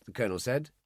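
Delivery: ordinary delivery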